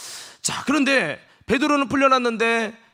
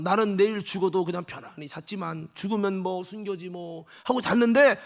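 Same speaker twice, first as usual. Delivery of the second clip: usual speaking voice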